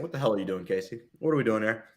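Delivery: normal talking voice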